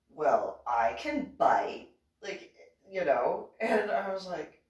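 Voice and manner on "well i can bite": a hint of a lower, slow boyfriend voice; slow, low and smug, lightly played; lightly voiced boyfriend. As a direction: boyfriend quoted, lower and slow, a mild boast. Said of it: "and he goes".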